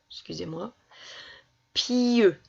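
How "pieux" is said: "Pieux" higher and louder, long i into eu; drawn-out syllable. Shown as a demonstration. Teacher asking which sound is heard, word drawn out. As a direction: call out "Pieux" with a stretched i. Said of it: "Qu'est-ce que j'entends?".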